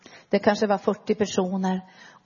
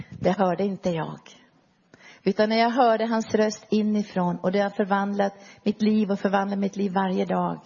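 normal talking voice

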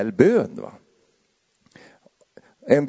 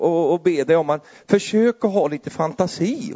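usual speaking voice

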